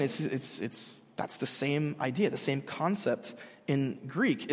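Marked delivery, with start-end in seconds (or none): none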